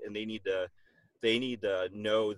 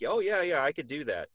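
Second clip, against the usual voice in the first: mock naive confidence; 7 semitones higher, 6 dB louder than usual. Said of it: "to be like".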